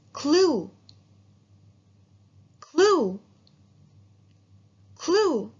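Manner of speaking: clue called out high and loud, long oo; model word clue. Shown as a demonstration. Teacher drills clue with the class, loud and clear. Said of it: "That's right".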